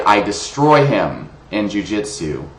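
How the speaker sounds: ordinary talk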